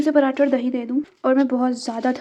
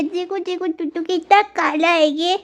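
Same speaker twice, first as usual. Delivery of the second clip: cooing at the cat; 6 semitones higher, 4 dB louder, slower than usual. Real, not acted.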